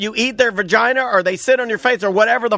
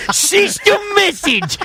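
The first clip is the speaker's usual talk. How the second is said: put-on high, slow, strained old man yell; old man scream; 12 semitones higher, slower than usual. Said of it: "You have an old man scream".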